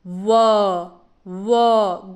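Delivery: "व" with rounded lips, gliding; English w. Shown as a demonstration. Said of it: "lips को गोल करके".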